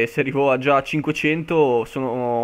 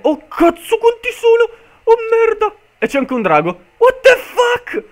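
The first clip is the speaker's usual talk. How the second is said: panicked screaming; 9 dB louder than usual. Real, not acted. Jumpscared by mob horde in game.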